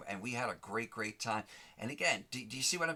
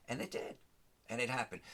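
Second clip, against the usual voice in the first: ordinary talk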